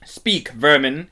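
loud, slow, contemptuous order; menacing command. A narrator's reading. Witch threatens the silent animals, menacing command. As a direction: order them to answer, drawn out.

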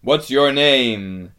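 loud, slow, commanding question; roared question. A narrator's reading. Aslan asks the giant his name, roaring. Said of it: "he roared".

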